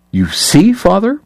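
high mocking imitation of a prig; triumphant mimicry. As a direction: parrot her back, gleefully shrill. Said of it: "Kate mimicked triumphantly".

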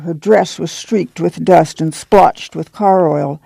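ordinary delivery

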